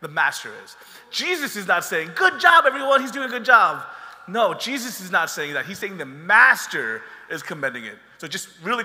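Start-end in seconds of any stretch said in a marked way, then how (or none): 2.1-3.8 high, loud cheery "good job" mock-up; mock praise. Preacher mocking praise Jesus never gives, loud and high.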